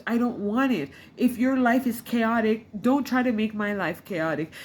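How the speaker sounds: usual speaking voice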